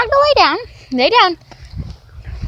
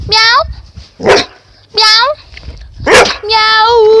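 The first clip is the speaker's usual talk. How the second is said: higher, louder mock meows; imitated meow; 5 semitones higher, 5 dB louder, much slower than usual. Shown as a demonstration. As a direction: meow repeatedly like a cat to prompt her. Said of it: "Lulu goes by meow".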